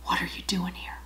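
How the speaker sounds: the Lord leaning in and whispering; breathy hush, close to the ear; soft whisper leaning in close. Retold or imitated. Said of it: "he says it in a whisper".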